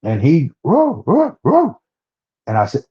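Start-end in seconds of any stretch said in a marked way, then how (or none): 0.6-1.8 high howling roars, climbing each time; retelling the creature's roar from the cedar tree; imitated creature roar. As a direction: mimic the Bigfoot's call, pitched way up. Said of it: "what sounded like a screech".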